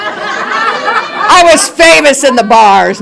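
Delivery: slow, high laughing brag; high laughing boast, slowed down. Real, not acted. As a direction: say it through a laugh, proud of it.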